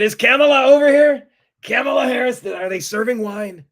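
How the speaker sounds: high, loud mock question; put-on voice. Boss joking back about Kamala, high loud put-on voice. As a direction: pitch it way up, loud, easing toward the end. Acted reply in a joke.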